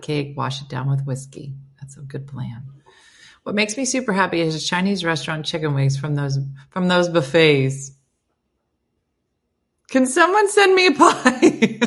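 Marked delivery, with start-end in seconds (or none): 1.8-2.6 soft whispered aside; whispering. Real, not acted. 9.9-11.9 high, loud laughing plea. Real, not acted.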